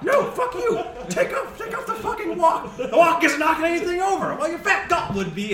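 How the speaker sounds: high, full-volume squabbling; angry shouting match. Acted. Two servers fighting over a wok, angry shouting. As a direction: trade insults at the top of your voice. Two cooks trade insults.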